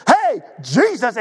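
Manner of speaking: high, slow, drawn-out eager hello; a keen disciple bursting to share news; excited eager call. Retold or imitated. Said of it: "he was all excited to tell Jesus this".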